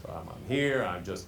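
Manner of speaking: low monotone; sit on the bottom note; low pitch. Acted. Speaker living on two pitches starts low, monotone. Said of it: "just on two pitches".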